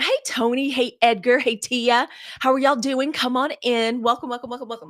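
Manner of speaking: excited greeting. Real, not acted. Greeting new viewers by name.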